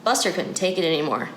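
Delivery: plain reading voice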